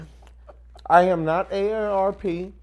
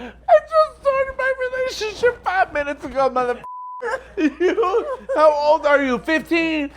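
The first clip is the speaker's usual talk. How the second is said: much higher, words bursting through laughter; mock outrage drowned in giggles; laughing; 10 semitones higher, 4 dB louder than usual. Real, not acted. Laughing at the caller about her age, mock outraged.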